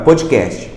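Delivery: "podcast" said the Brazilian way, like "pódi-kést-chi"; Brazilian pronunciation. Shown as a demonstration. Teacher showing viewers the word used in Brazil, Brazilian pronunciation. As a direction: say "podcast" with added i vowels, Portuguese-style. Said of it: "Igual no Brasil, a gente tem a palavra".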